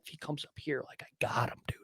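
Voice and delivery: hushed, eager whisper; excited whisper. Retold or imitated. Hunter whispering to his camera about the coming shot, excited whisper.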